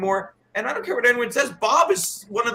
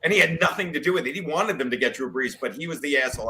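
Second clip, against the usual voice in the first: ordinary delivery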